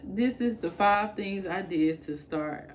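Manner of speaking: ordinary talk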